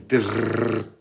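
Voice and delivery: imitates chattering teeth with a lip trill. Under the quilt on MDMA, mock teeth chattering. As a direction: rattle it out like teeth chattering from cold. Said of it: "teeth chattering".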